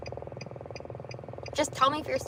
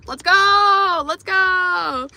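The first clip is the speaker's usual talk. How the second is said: excited cheer; 5 semitones higher, 11 dB louder, much slower than usual. Real, not acted. Celebrating getting a spot.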